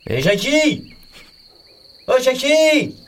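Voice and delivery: put-on loud, high shout after a child; calling out. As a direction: yell his name down the street. Chasing son who forgot lunchbox.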